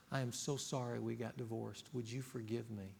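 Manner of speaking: soft, low and tender; sincere apology. Retold or imitated. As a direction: say it gently to the child. Model apology to child.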